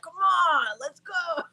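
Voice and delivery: high, rowdy pirate hype man; mock pirate holler, pitched well up; Johnny. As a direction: imitate a pirate rallying the crew. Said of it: "he sounds like a freaking pirate".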